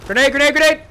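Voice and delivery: high, loud urgent callout; grenade warning yell. Real, not acted. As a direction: shout the warning to your team.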